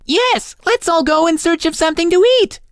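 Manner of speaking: very high chorus-of-dolls voice; dolls crying out. A narrator's reading. All the dollies agree to seek food, very high cry. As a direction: echo eagerly in a tiny, high voice. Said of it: "cried all the other dollies".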